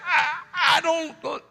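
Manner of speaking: put-on high, whiny little kid protest. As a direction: whimper it like a child refusing food. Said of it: "if we said anything like".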